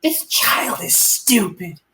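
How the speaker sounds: put-on slow, sneering little snake insulting the child; snooty snake voice. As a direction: drawl the insult slowly like a smug pet snake. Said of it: "he's like".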